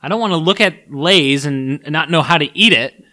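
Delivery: getting worked up and loud; fired up. Real, not acted. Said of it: "I'm getting angry now".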